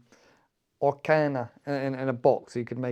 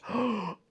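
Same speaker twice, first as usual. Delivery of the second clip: mock gasp; 7 semitones higher, 8 dB quieter than usual. Excited about fish finger sandwich.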